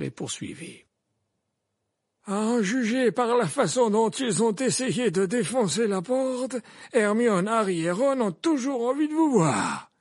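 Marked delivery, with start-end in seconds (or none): 2.3-9.9 put-on high, loud old wizard voice; gently probing old headmaster; Dumbledore voice. A narrator's reading. Dumbledore asks Hagrid about Hermione's shout, raised old wizard voice.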